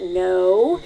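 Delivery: low, loud flat no from the husband; stubborn low no, played loud; the husband digging in with a low, loud no; flat denial. Back and forth argument, husband.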